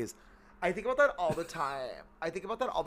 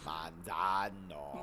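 slow app voice; 9 semitones lower, 9 dB quieter, much slower than usual. Retold or imitated. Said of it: "the slow speech version".

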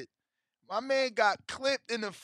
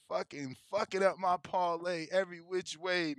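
ordinary talk

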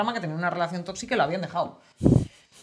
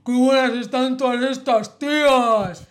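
slow, louder leering mock of a sleazy man; mocking sleazy guy; 4 semitones higher, 6 dB louder, slower than usual. Quoting an ex who ogled women, slow mocking leer. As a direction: drag it out like a lecherous creep ogling women.

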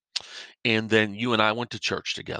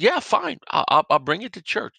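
casual friend; 10 semitones higher than usual. Acted. Friend casually agreeing to bring hammer.

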